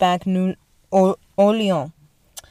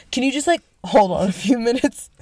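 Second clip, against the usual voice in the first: higher, laughing plea; pleading through laughter; 6 semitones higher, 3 dB louder than usual. Retold or imitated. Joan begging the captain, giggly plea. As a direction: ask sweetly while giggling. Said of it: "she's like".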